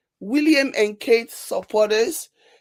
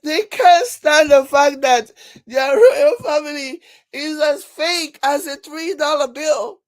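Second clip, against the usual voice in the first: amused exclamation; 5 semitones higher, 6 dB louder than usual. Real, not acted. Repeats the joke after laughing.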